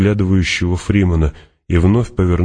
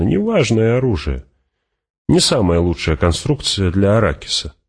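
animated higher voice with swooping pitch; Fremen warrior, casual; 3 semitones higher than usual. Acted. Fremen tells Hawat of the captured gun, breezy and high. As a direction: toss it off as a lively desert fighter.

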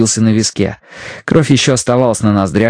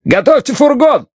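shouted command. A narrator's reading. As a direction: yell it at Bidwell. Johnston demands wagon, gun in hand.

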